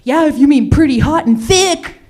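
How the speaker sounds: high, loud flattering friend; gushing college friend. Retold or imitated. College friend hyping her up, high and loud. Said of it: "she'd be like".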